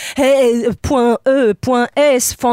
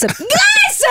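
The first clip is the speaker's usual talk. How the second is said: high shouted pun with a laugh; shrieked pun on digresse, laughing; punning yelp. Acted. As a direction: squeal the pun out.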